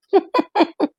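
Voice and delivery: short nervous laugh; a little embarrassed laugh. Real, not acted. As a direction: giggle caught out. Laugh after aside.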